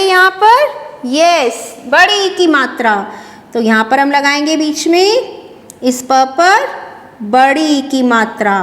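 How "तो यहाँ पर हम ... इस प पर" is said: normal talking voice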